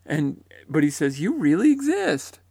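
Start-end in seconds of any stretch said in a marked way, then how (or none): 1.2-2.4 high, loud cry of amazement; astonished, much higher and louder; retold surprise. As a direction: exclaim it in acted disbelief. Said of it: "surprised to discover that he really exists".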